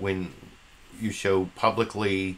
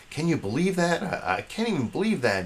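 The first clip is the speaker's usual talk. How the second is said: mock exasperated McCain; 9 semitones higher than usual. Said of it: "is like".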